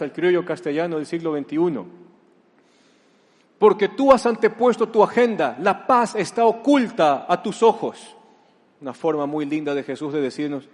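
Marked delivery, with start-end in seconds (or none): 3.6-8.2 loud, higher rebuke with a laugh at the end; loud declaration. Acted. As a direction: proclaim it hard, then break into a laugh. Frase de Jesús en criollo. (reproche fuerte y agudo que termina en risa)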